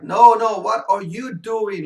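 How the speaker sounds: sudden loud high shout at her son; mom yelling. Retold or imitated. Mom yells at her eighteen-year-old son on the sofa, loud and high. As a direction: bark it out like a fed-up mom.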